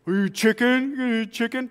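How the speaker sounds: high, mocking schoolyard jeer; taunting bully. Bully taunting the boy to fight, high mocking jeer. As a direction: needle him like a playground bully. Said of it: "I'm not gonna fight you".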